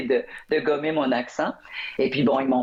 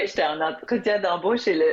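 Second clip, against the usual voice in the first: normal talking voice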